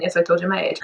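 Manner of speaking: ordinary talk, nothing marked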